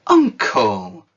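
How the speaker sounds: loud, urgent plea; pleading. Acted. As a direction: beg him to stop, calling out. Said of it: "pleaded the nephew".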